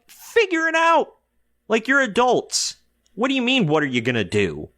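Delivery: high-pitched exasperated mock snap; imitated snapping. Wife answering her friends about the party, snapping in exasperation. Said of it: "she rightfully snaps at it".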